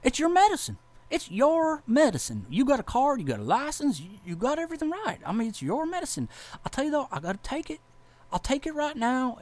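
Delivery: retold officer, high put-on voice; high-voiced officer. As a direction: play the officer kindly, voice pitched high. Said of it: "superior officer's like".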